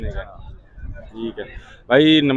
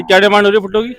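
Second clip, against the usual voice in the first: raised voice, high and loud; calling out; 8 semitones higher, 7 dB louder, much faster than usual. Real, not acted. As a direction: shout the question across the stalls. Calling out to a seller.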